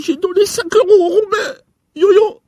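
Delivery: high, loud sobbing cry; hammed-up sobbing wail; wailing lament. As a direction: wail it like a child in despair. Wailing over the five euros.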